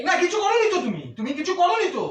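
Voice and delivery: high-pitched, indignant mock agreement; aggrieved, pitch swooping up; sarcastic retort. Real, not acted. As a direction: throw the denial back with sarcasm. Husband throws her denial back sarcastically.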